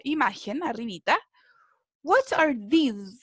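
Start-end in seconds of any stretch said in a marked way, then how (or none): 2.0-3.2 slow, deliberate What are these?; careful classroom demo; slow model question. Shown as a demonstration. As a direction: lay out each word for learners. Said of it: "¿Qué son".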